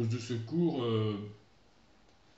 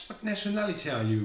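higher, questioning English voice; curious English speaker; 7 semitones higher than usual. Retold or imitated. Teacher imitating people abroad asking about his nationality, higher voice. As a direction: lightly voice a puzzled native asking. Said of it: "ils me disent".

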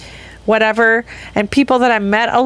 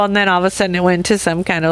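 ordinary talk

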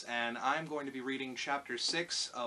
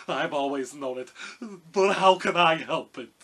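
loud, plaintive cowardly lion; ashamed lion; 4 semitones higher, 15 dB louder than usual. A narrator's reading. Lion confesses to Dorothy in shame, loud plaintive voice. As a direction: plead helplessly, rising louder. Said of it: "hanging his head in shame".

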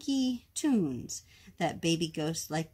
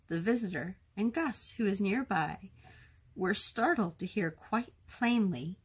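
ordinary delivery

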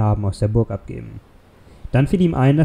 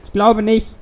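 high, loud yell; shouting back; 10 semitones higher, 4 dB louder than usual. A narrator's reading. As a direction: shout it back over the wind. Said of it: "brüllte Puck zurück".

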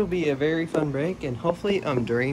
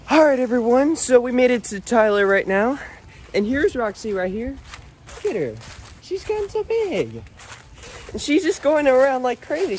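excited; 10 semitones higher, 4 dB louder than usual. Real, not acted. Excited arrival, dog jumping around.

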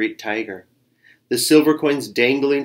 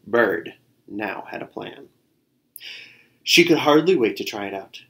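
ordinary delivery

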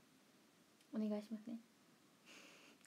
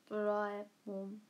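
Doraemon impression; 3 semitones lower than usual. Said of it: "ドラえもんやって。".

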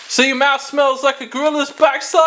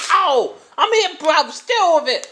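higher, cheeky gremlin squeak; weird gremlin voice; 6 semitones higher than usual. Acted. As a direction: voice a strong taste as a pushy little gremlin. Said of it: "where did you get that weird voice? sound like a gremlin".

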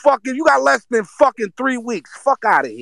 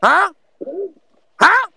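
rowdy challenge; 9 semitones higher than usual. Real, not acted. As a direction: call them out, pitched up. Drunk S0 confronting the laughers.

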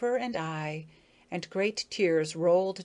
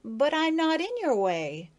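Maya's voice; 8 semitones higher, 3 dB louder than usual. Acted. Maya answers Bobby.

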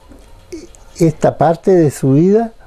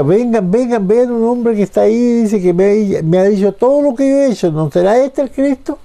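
startled calling out; 5 semitones higher, faster than usual. Retold or imitated. Woman calling townspeople to see the man, startled calling out. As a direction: call people over in a rush, pitched up. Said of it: "pienso yo que lo gritaba por".

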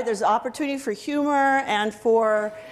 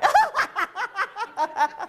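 high, rapid run of laughter; amused cackle; real laugh; 9 semitones higher than usual. Real, not acted. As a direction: laugh hard in a long burst. Laughs at the slapping exercise.

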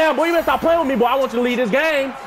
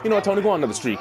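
usual speaking voice

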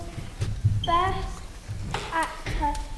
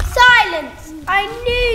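loud angry command; angry shout; 3 semitones higher, 10 dB louder than usual. Acted. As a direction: bark it to silence the room. King silences the room.

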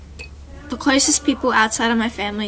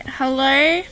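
normal talking voice

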